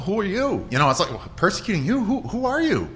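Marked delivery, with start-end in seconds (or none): 0.1-0.7 high-pitched puzzled question; baffled. Acted. Paul answering the voice from heaven, baffled. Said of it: "doesn't make sense to Paul". 1.4-2.9 high, incredulous questioning; baffled. Acted. Paul asking the unknown voice who it is, baffled. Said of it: "doesn't make sense to Paul".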